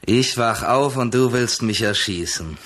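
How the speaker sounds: ordinary delivery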